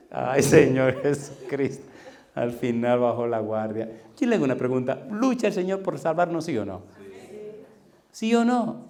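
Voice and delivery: ordinary delivery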